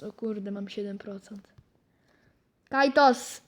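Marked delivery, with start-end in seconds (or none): 0.0-1.5 hushed panicked whisper. Real, not acted. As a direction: breathe it out low. Whispered panic about low health.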